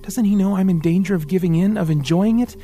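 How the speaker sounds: put-on a boy crying out at his fate; bitter anguish. A narrator's reading. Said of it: "His eyes became angry and bitter".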